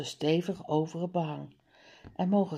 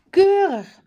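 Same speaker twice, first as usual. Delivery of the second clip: much higher and louder, a big proud "Keurig"; approving exclamation; 13 semitones higher, 11 dB louder than usual. Acted. Father praises the wallpaper with a loud high "Keurig".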